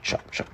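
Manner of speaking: voiceless chop chop like rotor blades; whispered chop sound. Acted. Narrator making Harold's rotor noise, whispered. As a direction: breathe out the chops like a helicopter.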